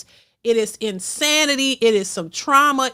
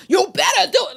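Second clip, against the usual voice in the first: mock demand; 6 semitones higher, 4 dB louder than usual. Mimics women shaming men.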